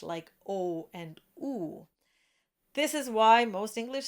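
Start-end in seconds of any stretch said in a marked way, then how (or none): 0.0-1.9 O and oo said slowly with the lips rounded; rounded back vowels. Shown as a demonstration. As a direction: show the rounded O and oo. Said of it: "Only back vowels are rounded in English".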